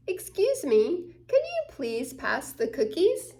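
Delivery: usual speaking voice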